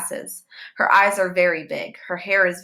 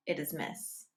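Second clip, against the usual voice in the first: usual speaking voice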